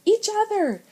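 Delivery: very high, loud shout; urgent shout. A narrator's reading. Big brother shouts an urgent answer before impact. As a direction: shout the answer over the danger. Brace for ice collision.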